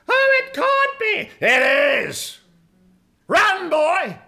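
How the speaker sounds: very high, loud mock panic; hammed-up alarm. Preacher acts out spotting the enemy, hammed-up alarm. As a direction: cry out in exaggerated alarm.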